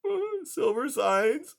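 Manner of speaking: high, timid plea; hesitant high pleading voice; pleading mouse. A narrator's reading. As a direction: beg like a frightened little mouse. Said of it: "Blinker pleaded".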